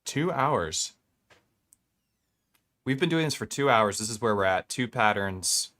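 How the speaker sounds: ordinary talk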